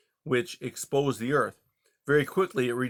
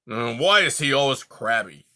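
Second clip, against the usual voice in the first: demanding; 6 dB louder than usual. Acted. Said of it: "Aerith demanded".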